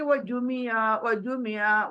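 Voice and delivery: ordinary talk, nothing marked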